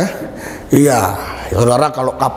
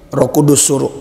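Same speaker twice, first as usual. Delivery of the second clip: ordinary delivery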